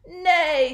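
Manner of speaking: loud, high falling neigh; horse neigh. A narrator's reading. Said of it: "said the horse".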